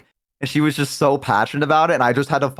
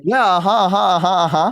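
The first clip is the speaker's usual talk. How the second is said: fake listening; 4 semitones higher, slower than usual.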